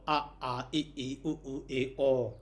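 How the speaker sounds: pure open vowels a, i, u, e, o; each vowel left open, a to o; no nasal ending. Shown as a demonstration. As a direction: recite a, i, u, e, o with no nasal m. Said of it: "Without Nighita".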